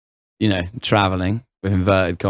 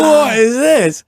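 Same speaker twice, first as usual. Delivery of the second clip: high, loud, slow incredulous cry; retelling a shocked reaction to the kick; shocked disbelief; 13 semitones higher, 6 dB louder, much slower than usual. Retold or imitated. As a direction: react like you can't believe your eyes. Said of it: "And I'm like".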